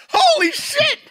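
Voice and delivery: hugely excited, high loud exclamation; excited shout. Retold or imitated. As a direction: blurt it out in amazement, high and loud. Retold excited reaction to news.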